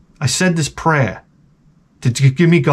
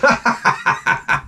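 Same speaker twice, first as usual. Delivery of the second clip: high breathless mock laugh; mocking salesman laugh; 9 semitones higher, 3 dB quieter than usual. Salesman laughing at a car buyer, mock laugh. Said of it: "They're like".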